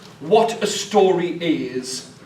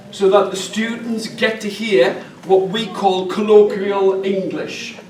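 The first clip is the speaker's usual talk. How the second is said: ordinary talk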